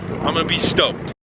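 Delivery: usual speaking voice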